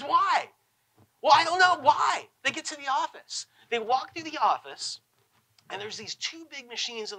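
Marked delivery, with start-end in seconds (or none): 0.0-2.3 high and loud, mounting frustration; building exasperation. Retold or imitated. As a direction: ramp up the back-and-forth louder. Child and dad, rising frustration.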